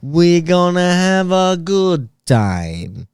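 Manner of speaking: slow, high robot voice. Retold or imitated. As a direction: stiff robot voice, each word even. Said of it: "talk like a robot".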